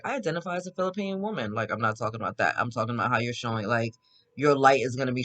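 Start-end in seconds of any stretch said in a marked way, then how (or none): 0.0-1.5 higher, fast mock voice of a man making a claim; a hint of sarcastic caricature, pitched up; mocking impression. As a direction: lightly parody the guy's earnest claim, quick and raised.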